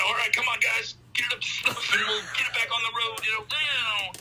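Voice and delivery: high-pitched, hammed-up racing driver rallying the crew; Brad Pitt. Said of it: "Brad Pitt from the new F one movie".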